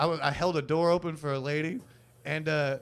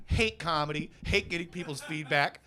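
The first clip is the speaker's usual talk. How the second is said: ordinary talk, nothing marked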